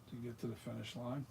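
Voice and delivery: ordinary talk, nothing marked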